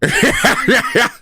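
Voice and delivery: high burst of real laughter. Real, not acted. Vlogger cracking up at a soundboard clip, high laugh. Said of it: "the way I'm laughing at this".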